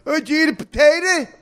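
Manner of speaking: very high, loud caricature Irish voice; mock a hacky Irish bit in a squeal. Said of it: "And then you had".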